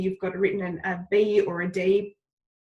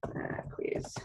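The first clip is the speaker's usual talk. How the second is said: breathy whisper to herself; whispered aside; 10 dB quieter than usual. Real, not acted. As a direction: mutter it under your breath. Muttering to herself while editing.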